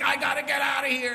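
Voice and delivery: high-pitched imitated holler; mock yelling. Woman yells back in street squabble, high mock yell. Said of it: "a man and a woman yelling".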